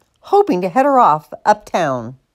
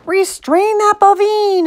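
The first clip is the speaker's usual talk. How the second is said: high, urgent holler; raised-pitch call to stop someone; guard's cry; 11 semitones higher than usual. A narrator's reading. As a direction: cry out the alarm, stretching bovine. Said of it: "a guard cried".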